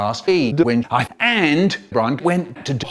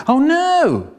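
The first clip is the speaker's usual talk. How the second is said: shocked cry; 12 semitones higher, 4 dB louder than usual. Acted. Dad dies, shocked outcry.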